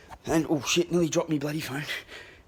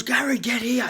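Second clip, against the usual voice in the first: high-pitched officer calling out an order; mock yell, pitched well up; 9 semitones higher than usual. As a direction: imitate a guard yelling someone over. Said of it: "he's yelling at me".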